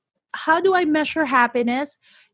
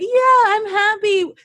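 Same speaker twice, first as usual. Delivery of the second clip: mock kid answer; 10 semitones higher, 6 dB louder, slower than usual.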